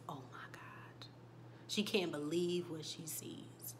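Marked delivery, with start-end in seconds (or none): 0.1-1.1 whispered, much softer gasp of shock; stunned whisper at the children. Retold or imitated. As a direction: barely breathe it out in disbelief. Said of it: "she is shocked".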